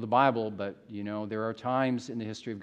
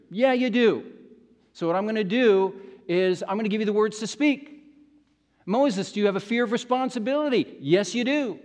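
loud, raised-pitch rebuke, acted; angry God; 6 semitones higher, 6 dB louder than usual. God confronting fearful Moses, angry rebuke. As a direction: confront him forcefully, answering your own questions. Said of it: "God gets angry. And it's like God saying".

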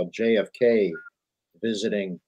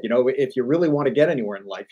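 normal talking voice

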